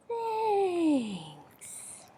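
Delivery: a shy, touched thank you; soft bear voice. Acted. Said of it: "And the bear says".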